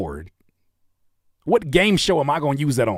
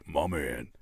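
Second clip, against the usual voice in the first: very low, soft put-on voice; deep mock voice; 11 semitones lower, 10 dB quieter than usual. Host imagining another voice, deep mockery. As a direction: drop it right down, quietly. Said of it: "Imagine your voice is like this".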